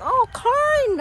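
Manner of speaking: sudden high delighted Oh, corn!; thrilled little shout of delight; excited discovery. Real, not acted. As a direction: jump up high at seeing the corn. Spots corn at stand.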